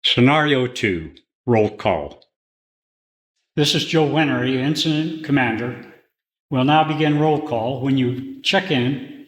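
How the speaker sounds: ordinary talk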